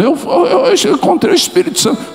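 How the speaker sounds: high, fast, excited insistence; excited testimony. Retold or imitated. Old man to the psychiatrist, excited, high and fast. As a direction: blurt it out, bursting with joy.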